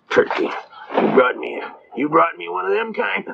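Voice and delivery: drawn-out, half-voiced grumble; lightly voiced griping prosecutor; mock exasperated prosecutor. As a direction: grumble it slowly, imitating an annoyed lawyer. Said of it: "the prosecutor will go".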